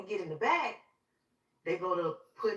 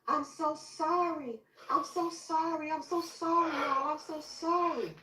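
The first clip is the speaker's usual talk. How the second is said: high, drawn-out tearful apology; anguished repeated apology, retold; retold bawling; 9 semitones higher, slower than usual. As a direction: wail the apology slowly, pitched way up. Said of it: "I just started bawling".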